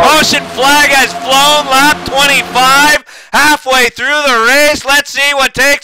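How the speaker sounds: slow, stretched announcer delivery; race announcer call. Real, not acted. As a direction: announce the caution, drawing out each phrase. Announces caution flag.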